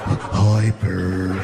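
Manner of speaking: a strung-out elephant saying hi; junkie Snuffy. Pretend.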